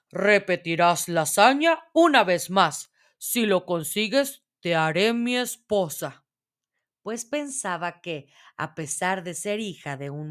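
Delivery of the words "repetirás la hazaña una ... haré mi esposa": lightly voiced king; king's announcement. A narrator's reading. King ordering the girl to spin once more, lightly voiced announcement. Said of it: "anunció".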